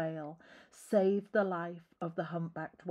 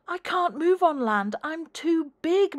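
moaned; 10 semitones higher, 9 dB louder than usual. Acted. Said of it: "moaned the whale".

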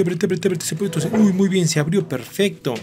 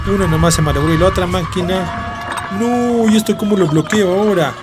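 loud, raised-pitch cries of dismay; loud alarm; 3 semitones higher, 6 dB louder than usual. Real, not acted. Gamer to viewers as the machine explodes, loud alarm. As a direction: cry out as it all goes wrong.

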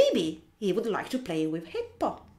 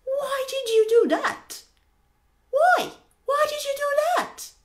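high, loud, protesting questions; indignant flamingo; 7 dB louder than usual. Acted. Flamingo asking hippo why he grabbed it, high indignant voice. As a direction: demand it in a high, upset bird voice. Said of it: "Flamingo goes".

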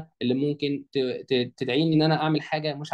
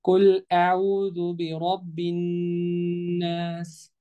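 very slow tajweed chant; teacher's model recitation, drawn out; Quran recitation; much slower than usual. Shown as a demonstration. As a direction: sustain the long vowels and hummed nn on one note. Recites the verse.

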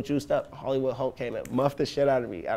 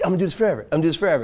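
higher, excited mock vow; acted elation; 5 semitones higher, 4 dB louder, much faster than usual. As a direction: declare it like an artist riding a high. Elated artist after a good show.

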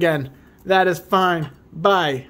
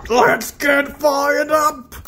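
high, slow, drawn-out hype call; hype catchphrase; 9 semitones higher, much slower than usual. Real, not acted. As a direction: stretch it out like a rally cry. Hype catchphrase before next pack.

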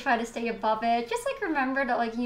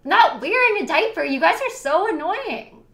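louder and higher, fed up with chat; loud annoyed outburst; 6 semitones higher, 8 dB louder than usual. Real, not acted. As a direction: shout it out, exasperated. Streamer snaps at teasing chat.

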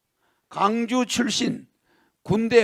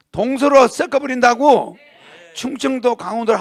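proclaiming; 3 semitones higher, 6 dB louder than usual. Real, not acted. Proclaiming his campaign pledge.

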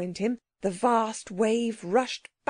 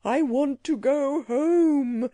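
slow, higher-pitched fierce creature cry; 4 semitones higher, 3 dB louder, slower than usual. A narrator's reading. Frightened creature demands to go home, crying out fiercely. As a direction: cry it fiercely, drawing the words out. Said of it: "cried the Psammead fiercely".

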